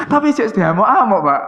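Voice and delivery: loud laughing exclamation; half-laughing indignation; mock outrage. Real, not acted. Laughing at being scolded.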